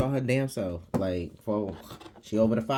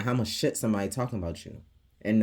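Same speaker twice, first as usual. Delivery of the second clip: normal talking voice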